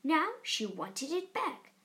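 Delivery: plain reading voice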